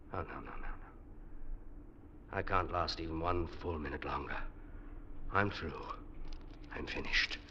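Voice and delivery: hushed, hopeless no, no, no; despairing whisper. Acted. Stranded climber giving up, a breathy despairing whisper. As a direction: sink into a near-whisper of despair.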